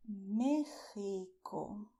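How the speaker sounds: Mexico with x as throaty h, drawn out; Spanish way. Shown as a demonstration. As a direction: say Mexico slowly as "MEH-hee-ko". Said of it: "in Spanish the right way".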